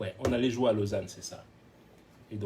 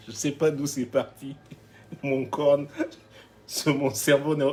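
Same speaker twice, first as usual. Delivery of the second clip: laughing through the excuse; real laughter owning up to the headbutt; 2 semitones higher, 5 dB louder than usual. Real, not acted. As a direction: chuckle while telling it. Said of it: "laughs over the headbutt".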